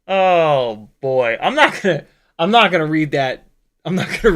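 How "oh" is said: usual speaking voice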